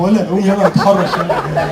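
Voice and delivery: slow, leering mock sleaze; sleazy guy voice. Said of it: "لو صاحبه نايم جنبه".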